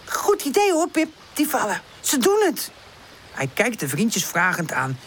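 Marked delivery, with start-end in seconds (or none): 0.1-2.7 high, eager character voice; high-pitched, excited storybook chatter; enthusiastic. A narrator's reading. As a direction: praise the idea brightly and eagerly. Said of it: "zegt enthousiast".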